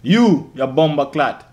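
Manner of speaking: put-on slow, drawn-out Jamaican accent. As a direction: lay the Jamaican accent on thick. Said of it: "I see you do your Jamaican accent".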